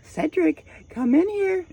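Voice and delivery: very high, eerie sing-song call; creepy calling voice. Retold or imitated. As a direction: lure him back in with a shrill, haunting call. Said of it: "Like, just like that".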